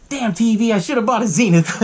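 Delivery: a hint of an irritated old neighbor; mock grumbling neighbor. Retelling the neighbor cursing his TV, mock complaint. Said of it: "he's just sitting there watching T V".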